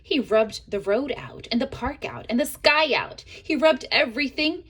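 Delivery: ordinary delivery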